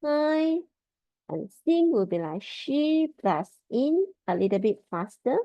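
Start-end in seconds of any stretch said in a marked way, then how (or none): 0.0-0.6 "hi" held high and level; first tone. Shown as a demonstration. Teacher showing learners how 开 sounds, high level tone. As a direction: sing "hi" on one high steady pitch. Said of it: "first tone".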